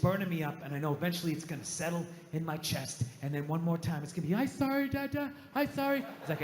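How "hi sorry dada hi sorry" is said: lightly voiced little kid, higher; a hint of a timid child saying sorry; small child apologising. Kid apologising to Dada, lightly voiced child.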